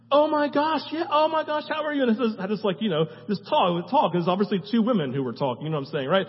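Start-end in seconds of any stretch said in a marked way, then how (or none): 0.1-2.0 higher, delighted phone greeting; excited mom. Retold or imitated. Mom answering the phone, recognizing the caller, higher and delighted. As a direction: mom gushing at a familiar voice on the line. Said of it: "My mom answers it after dinner and says".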